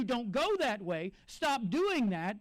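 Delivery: slow, deliberate caution; gentle slow warning; slow pleading warning. Retold or imitated. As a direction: plead with them to stop. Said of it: "have someone tell you".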